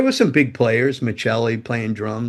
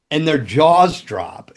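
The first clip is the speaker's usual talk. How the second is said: ordinary delivery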